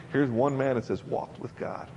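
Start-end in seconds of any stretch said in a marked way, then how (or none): 1.0-1.9 hushed whisper of "Walked with God"; a reverent whisper of "Walked with God". Acted. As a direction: breathe it in awe. Awed quote of scripture.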